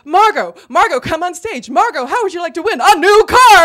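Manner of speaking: loud, high, building to a shout; game show host. Retold or imitated. Host calling the winner on stage, big excited announcement. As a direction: build up to the big prize reveal.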